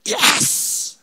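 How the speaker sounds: high breathy cheer of victory; triumphant yes. Retold or imitated. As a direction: celebrate a win under your breath. Imagined success moment.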